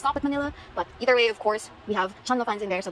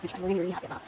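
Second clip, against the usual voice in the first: usual speaking voice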